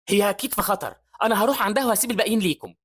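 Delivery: high, urgent declaration; urgent while running. A narrator's reading. Hazar running off to save Evie, urgent. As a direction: call it back while rushing off. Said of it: "بيقول هازر وهو بيجري".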